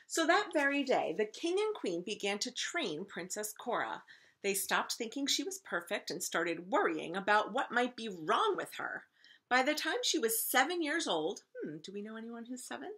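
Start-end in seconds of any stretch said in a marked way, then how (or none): 0.1-11.4 loud, animated read-aloud; emphatic story narration. A narrator's reading. Teacher reading to first graders, emphatic story narration. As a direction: narrate with big stresses on key words.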